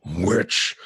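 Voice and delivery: low minotaur growl of contempt; deep, menacing monster voice; snarling minotaur. Acted. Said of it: "he looks right at Clara and he goes".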